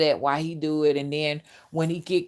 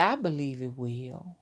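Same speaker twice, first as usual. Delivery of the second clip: low seductive pastor voice; 5 semitones lower, 7 dB quieter than usual. Retold or imitated. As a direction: lower and softer, mock seductive. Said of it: "you could hear it in his voice".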